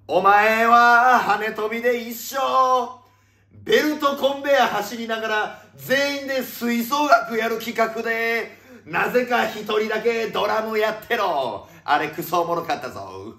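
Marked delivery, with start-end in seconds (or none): 0.1-11.7 high, strained yell with stretched endings; comic shouted rant. Pretend. Host condemning someone to drum forever, comic shouted rant. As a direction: holler each phrase like a sentence being passed.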